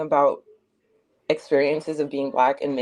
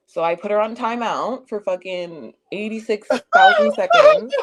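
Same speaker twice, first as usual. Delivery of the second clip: rising higher and louder to a squeal on the last word; excited build; 5 semitones higher, 6 dB louder than usual. Real, not acted. Telling the stream about the timeout, excitedly louder. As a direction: pump it up to a squeal.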